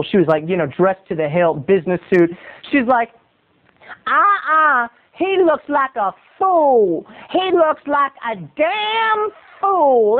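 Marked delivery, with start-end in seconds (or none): 4.1-10.2 high, slow, drawn-out disapproval; put-on voice of a cool woman passing judgment; cool, disapproving lady. Lady in business suit judges the stretcher, disapproving. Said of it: "she was so cool".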